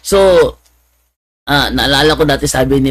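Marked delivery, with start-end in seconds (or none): none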